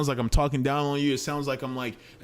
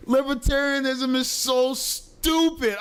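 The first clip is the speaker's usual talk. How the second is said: exasperated; 13 semitones higher, slower than usual. Real, not acted. Reacting to clip, exasperated.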